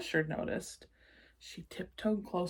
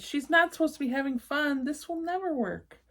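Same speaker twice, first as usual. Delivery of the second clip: put-on worried child; 8 semitones higher, 4 dB louder than usual. Child panics in story.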